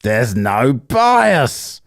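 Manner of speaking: very slow, drawn-out mocking repeat; mock echo. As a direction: drag his words out to ridicule them. Mocking echo of debater's line.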